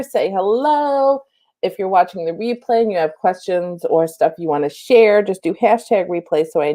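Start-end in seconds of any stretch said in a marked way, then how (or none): 0.0-1.2 sing-song hello. Real, not acted. Inviting viewers to greet.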